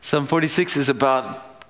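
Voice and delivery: ordinary talk, nothing marked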